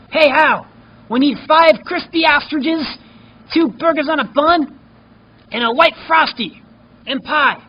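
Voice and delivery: high, loud call to the back; calling to kitchen. Acted. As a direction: shout the order to the cook. Waitress yelling order to kitchen.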